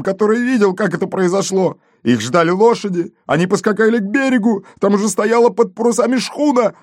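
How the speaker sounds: high-pitched, excitable gendarme; agitated account. A narrator's reading. Gendarme reporting the gringo's escape to his chief, agitated. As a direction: keep the tale tumbling out in a high voice.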